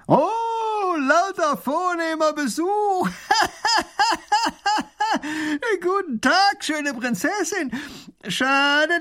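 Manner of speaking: high, loud, slow mocking robber, laughing between words; booming robber voice. Robber taunts the princess across the river, loud and mocking. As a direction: crow over the rich visitors with a big jeering laugh. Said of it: "sein dröhnendes Lachen".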